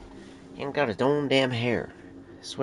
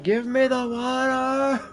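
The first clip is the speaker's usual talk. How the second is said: much higher, slow, whiny cat voice; voicing the demanding cat; 14 semitones higher, 3 dB louder, much slower than usual. Owner voices the cat begging for water, high and slow. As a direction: stretch it out like a needy cat. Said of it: "At you, like".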